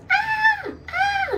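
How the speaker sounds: very high wailing newborn cry; imitated baby cry. Mother imitating her son's first cry in theatre, high newborn wail. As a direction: squeal it like a newborn crying.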